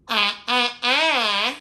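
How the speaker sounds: high, loud witch sounds. Shown as a demonstration. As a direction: cackle out like a witch. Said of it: "Make yourself sound like a witch".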